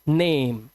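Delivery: नेम with a long "ay" vowel; long E sound. Shown as a demonstration. Teacher showing learners the A in this word, long "ay" in नेम. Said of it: "ये ए की मात्रा आई".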